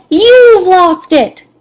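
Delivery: loud, high, slow accusation; huffy Rabbit accusing Piglet. Acted. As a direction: lay the blame on him hard. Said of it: "very accusing".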